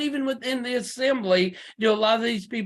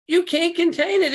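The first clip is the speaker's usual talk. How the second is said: much higher and louder, preached out; emphatic repeat; 8 semitones higher, 3 dB louder than usual. Real, not acted. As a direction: lift it up and drive it home.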